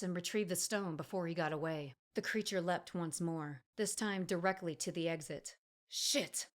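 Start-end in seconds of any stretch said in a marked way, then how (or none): 5.9-6.5 sharp exclamation. A narrator's reading. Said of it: "Emery exclaimed".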